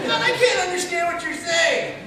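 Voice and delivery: very high, loud and frustrated; exasperated Human Torch. Retold or imitated. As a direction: squeak it out like a hyper hero fed up. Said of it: "the Human Torch is up here".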